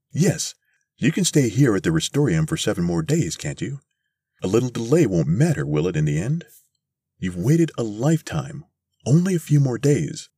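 placating salesman. A narrator's reading. Mister Thurkell asks the ladies to wait a week, placating.

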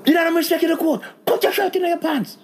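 high-pitched court official telling him off; mock scolding. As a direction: scold in a high, squeaky imitation. Acted scolding by court woman.

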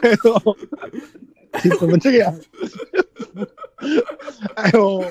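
laughing. Real, not acted. Laughing at friend's odd remark.

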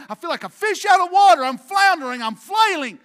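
high, full-volume preacher's shout; desperate outcry. Retold or imitated. Preacher acting David as a fish out of water, shouted desperation. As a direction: shout it like someone floundering and helpless.

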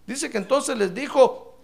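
usual speaking voice

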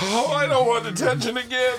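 put-on high, whining kid begging out of detention; whiny scolded kid. Student pleading with the teacher, whiny and high. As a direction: whine like a kid caught out.

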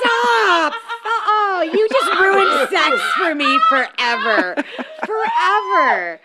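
loud, laughing disgusted cry; laughing outburst. Real, not acted. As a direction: shriek it through laughter, horrified.

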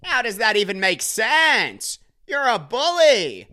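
high, drawn-out indignant comic wail; John Mulaney. Retold or imitated. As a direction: stretch each phrase high, then let it slide down. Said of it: "It's John Mulaney".

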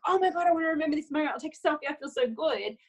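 quick, high, gushing rush; excited. Retold or imitated. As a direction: gush fast and high about the moment. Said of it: "you're like".

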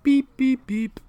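high squeaky robot beeps; toy robot voice. Retold or imitated. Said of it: "Patrick goes".

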